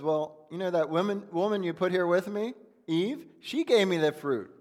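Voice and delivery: a hint of a whiny Adam blaming his wife. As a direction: push the blame onto Eve. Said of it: "he's blaming Eve".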